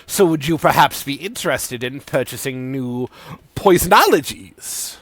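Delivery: peddle potions like a carnival quack; Sidon sales pitch. Acted.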